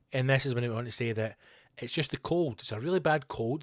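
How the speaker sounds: ordinary talk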